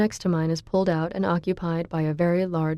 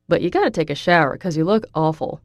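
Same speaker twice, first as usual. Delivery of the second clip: quick, louder, teasing grin in the voice; cheeky teasing; 4 dB louder than usual. A narrator's reading. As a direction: tease her with a grin. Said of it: "giving me a cheeky grin".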